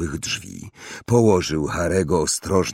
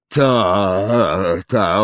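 muffled voice; 4 dB louder than usual. A narrator's reading. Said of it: "odezwał się Hagrid stłumionym głosem".

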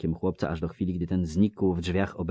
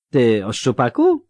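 loud, higher, slurring tipsy villager; drunk Melfi; 6 semitones higher, 11 dB louder than usual. Acted. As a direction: call out like a cheery drunk. Melfi speaks. (pijany Melfi, głośno i bełkotliwie)

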